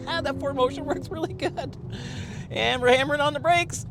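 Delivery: real excitement mid-test; thrilled and loud. Real, not acted. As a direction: shout it over the rush of the brake test. Hard braking during test.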